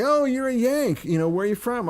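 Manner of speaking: high-pitched, welcoming northerner imitation; friendly northern local. Northern local meeting a Yank, high friendly imitation. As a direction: pitch it up high, interested in the visitor. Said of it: "And people would say".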